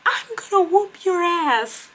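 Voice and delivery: hammed-up high angry warning; mock threat. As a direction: imitate the coworker vowing a whooping. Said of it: "she's like".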